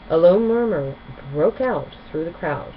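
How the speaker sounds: ordinary delivery, nothing marked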